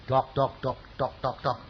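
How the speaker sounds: soft spoken knocking sounds, dok dok dok; soft mouth-made knocking on a door; imitated door knock. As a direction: tap out the knocks with your voice, quietly. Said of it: "saya ketok".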